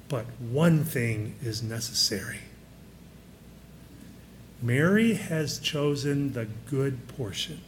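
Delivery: calm a worried friend softly; gentle Jesus. Acted. Jesus gently answers Martha.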